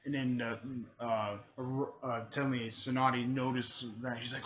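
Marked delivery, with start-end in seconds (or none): none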